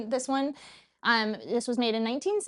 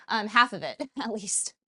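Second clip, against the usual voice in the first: ordinary talk, nothing marked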